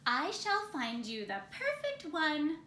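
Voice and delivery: ordinary delivery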